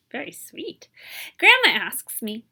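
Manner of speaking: ordinary delivery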